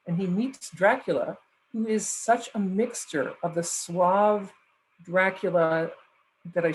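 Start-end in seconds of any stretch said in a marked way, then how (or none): none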